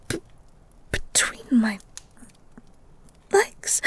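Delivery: breathless, barely voiced; whispering it so nobody hears; embarrassed whisper. Flustered girl whispers to the beast.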